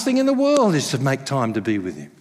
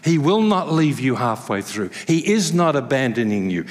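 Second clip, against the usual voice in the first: ordinary talk, nothing marked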